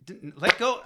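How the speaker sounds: usual speaking voice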